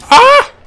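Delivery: sharp high yelp; scream. Real, not acted. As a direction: yelp in surprise. Sudden hit in game.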